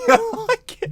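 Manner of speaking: high, breaking into laughter mid-word; helpless laughter. Real, not acted. Reactor unable to take the excuse, helpless laughing. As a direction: laugh too hard to finish. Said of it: "Bar be laughing".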